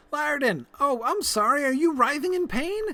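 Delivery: high, loud, gleeful taunt; mock triumphant gloating. Taunting the game villain Lyrdon, high gleeful gloat. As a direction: crow over catching him at last.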